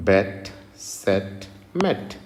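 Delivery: slow demo. Shown as a demonstration. Teacher reading phonetic forms to students, slowly. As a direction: spell out bet, set slowly. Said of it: "ఇది వాటి యొక్క phonetic రూపం".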